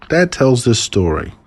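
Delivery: ordinary delivery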